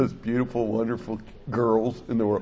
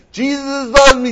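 "Jesus loves me" higher and louder, slurred like babbling in tongues; mock tongues; 12 semitones higher, 11 dB louder than usual. Grandpa imitating his granddaughter speaking in tongues, higher and louder. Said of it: "Then she starts speaking in tongues".

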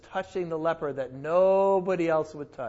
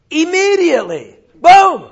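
high, loud burst on boom; dramatic boom; 9 semitones higher, 12 dB louder than usual. Acted. Preacher to his class, acting out the instant healing, loud and excited. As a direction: hit it like a sudden miracle.

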